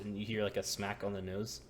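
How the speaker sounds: normal talking voice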